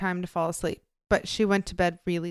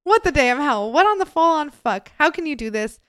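incredulous outburst; 7 semitones higher, 7 dB louder than usual. Real, not acted. Outraged at the story.

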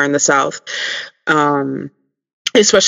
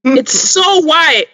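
drawn-out mocking; 11 semitones higher, much slower than usual. Podcast host mocking a character, slow high sneer. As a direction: stretch it out high in mock disgust.

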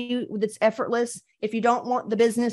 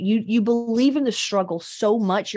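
normal talking voice

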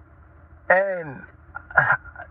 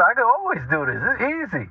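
mildly cocky, higher-pitched self-quote; accomplished; 6 semitones higher than usual. Retold or imitated. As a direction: act out pleased overconfidence. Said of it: "How accomplished I felt".

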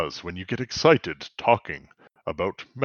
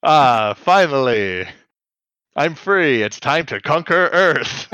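louder, higher freed-villain gloat; Rita villain line; 4 semitones higher, 4 dB louder than usual. Retold or imitated. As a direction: relish the escape. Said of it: "that's what Rita says".

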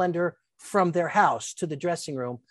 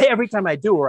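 normal talking voice

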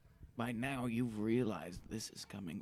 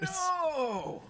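shocked scream; 8 dB louder than usual. Acted. Reacting to villain reveal.

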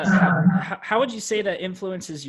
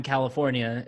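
ordinary delivery

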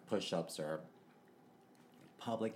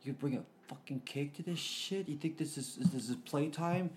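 higher, contemptuous bro voice; mocking frat bro; 6 semitones higher than usual. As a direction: sneer like a hazing frat brother. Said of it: "the brothers were like".

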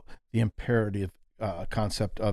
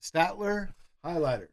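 southern accent; 7 semitones higher than usual. Real, not acted. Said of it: "your southern accent came out".

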